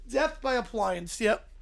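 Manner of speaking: normal talking voice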